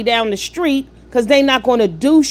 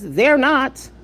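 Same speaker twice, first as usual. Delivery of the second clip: usual speaking voice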